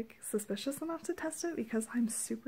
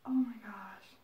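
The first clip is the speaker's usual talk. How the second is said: whispering; 2 semitones lower than usual. Real, not acted. Quiet awe.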